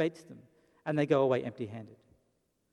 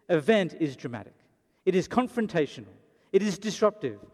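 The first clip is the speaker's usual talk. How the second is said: normal talking voice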